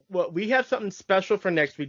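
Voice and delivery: ordinary talk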